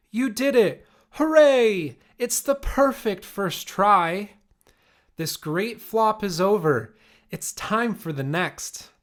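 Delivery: high, loud cheering hooray; joyful cheer. Acted. Great-great-aunt Rose cheers Rosie's crash, high and loud. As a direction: burst out cheering her on.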